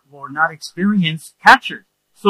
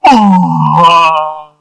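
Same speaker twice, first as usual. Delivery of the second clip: loud drawn-out cry; imitated cry out; 13 dB louder than usual. Imitating the man on the floor, loud cry. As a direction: cry out like in ecstasy or pain. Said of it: "He was like".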